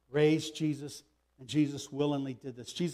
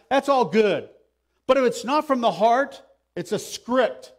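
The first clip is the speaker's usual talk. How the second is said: loud, raised-pitch sermon delivery; forceful preaching; 5 semitones higher, 7 dB louder than usual. Real, not acted. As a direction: hammer the message home to the congregation. Preaches the passage at full force.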